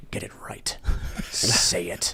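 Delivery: menacing whisper from behind the scenes; hushed, breath-only threat, acted. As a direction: hiss the order very softly.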